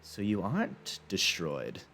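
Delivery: soft, slow realisation; quiet surprised collector. Acted. Collector realises the wizard still lives, softly and slowly. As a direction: let it dawn on you quietly. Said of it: "he goes".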